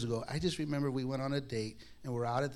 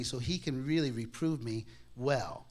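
ordinary delivery

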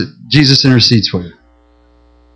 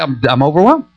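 usual speaking voice

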